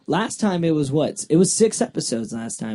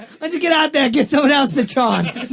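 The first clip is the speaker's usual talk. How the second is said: loud, high mock dad rant; angry dad; 12 semitones higher, 6 dB louder than usual. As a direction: yell through the bathroom door like an annoyed father. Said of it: "what my dad used to tell me".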